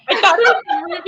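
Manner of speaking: can barely get it out for laughing; laughing hard. Real, not acted. Laughing at her own dumb theory.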